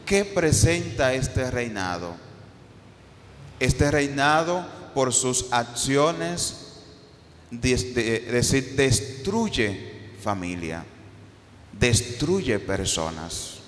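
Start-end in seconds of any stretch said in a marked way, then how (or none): none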